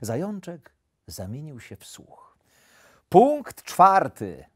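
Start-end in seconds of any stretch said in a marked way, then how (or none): 3.1-4.4 slow, loud, higher proclamation; emphatic, stretched-out announcement; reading out a rule. A narrator's reading. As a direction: read it out like an official decree. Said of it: "przeczytał wilk".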